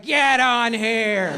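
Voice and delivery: slow, hammed-up holler; drunk friend. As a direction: stretch every word like a pushy drunk. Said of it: "Waze is your drunk friend".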